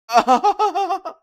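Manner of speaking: high, loud disbelieving laugh; loud shrieking laugh. Real, not acted. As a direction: burst out laughing in shock. After shocked ranting.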